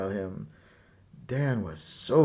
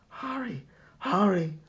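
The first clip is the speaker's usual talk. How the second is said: urgent inner voice; 9 semitones higher than usual. A narrator's reading. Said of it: "a voice inside him cried".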